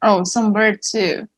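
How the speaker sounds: usual speaking voice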